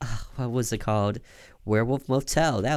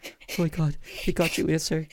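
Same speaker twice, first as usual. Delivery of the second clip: frantic high voice, pitched up; panicked caller; 9 semitones higher than usual. Acted. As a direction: gasp it out in a panic.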